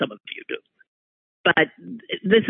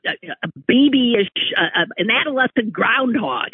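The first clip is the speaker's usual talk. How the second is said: usual speaking voice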